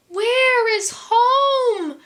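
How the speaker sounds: high, lost little penguin call; crying out. A narrator's reading. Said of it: "Pip cried".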